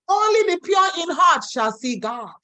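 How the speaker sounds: Only the pure in heart shall see God shouted up high; emphatic shout. Shown as a demonstration. Preacher repeats the verse to the congregation, shouting it out. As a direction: proclaim it to the whole church. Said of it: "repeats line for emphasis".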